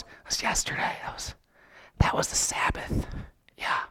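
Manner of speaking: voiceless whisper among themselves; whispered aside. Acted. Pharisees whispering to each other about the Sabbath. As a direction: whisper it so the man can't hear.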